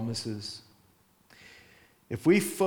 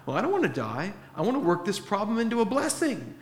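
mock pleading, pitched up; reluctant protest; 9 semitones higher, faster than usual. Believer resisting dying to the flesh, reluctant protest. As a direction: imitate someone bargaining to avoid it.

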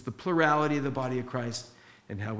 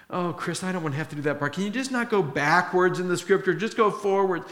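mock complaint, pitched up and louder toward the end; reluctant objection; 6 semitones higher, 5 dB louder, faster than usual. Congregant asking Chris to skip that part, reluctant objection. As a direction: imitate a listener pleading to skip ahead.

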